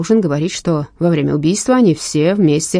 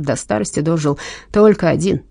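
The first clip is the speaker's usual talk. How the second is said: ordinary delivery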